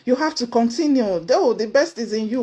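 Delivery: ordinary talk, nothing marked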